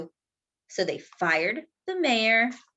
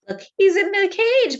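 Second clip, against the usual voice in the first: excited; 10 semitones higher, 5 dB louder than usual. Real, not acted. Excited reading to kids.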